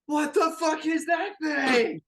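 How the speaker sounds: high, loud shriek of disbelief; horrified shout. Acted. Sailor seeing a dripping thing on deck, horrified shout.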